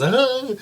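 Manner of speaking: high, loud mock scream; imitated startled yelp. Imitating the neighbor flipping out on seeing her, startled yelp. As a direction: yelp like someone caught by surprise. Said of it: "and he goes".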